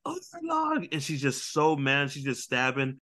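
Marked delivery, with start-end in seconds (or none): 0.1-0.9 high, strained cry of the name; a retold anguished cry of her enemy's name; anguished Nami. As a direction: wail the name in pain. Nami voiced, stabbing her arm.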